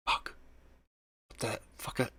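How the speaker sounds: breathless whispered swear; a shocked, voiceless what the fuck; stunned whisper. Real, not acted. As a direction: hiss it under your breath in shock. Stunned after seeing jaguar fangs.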